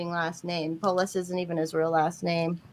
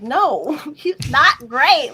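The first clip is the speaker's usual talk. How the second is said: higher and louder, laughing it out slow; laughing retort; 7 semitones higher, 7 dB louder, much slower than usual. Retold or imitated. Retorting to a fan of the governor, laughing scornfully. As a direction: scoff at the praise through laughter. Mocking a politician's praise, retorting.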